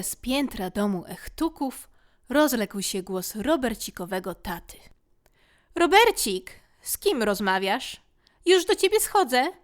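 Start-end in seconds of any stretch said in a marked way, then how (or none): 5.7-9.5 loud, higher call across the house; father calling his son from another floor; calling from upstairs. Acted. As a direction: shout up the stairs as the father.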